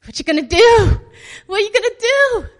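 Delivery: loud, high hollered question; dramatic taunt. Acted. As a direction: holler it like a viewer hooked on the drama. Acts out relishing the drama.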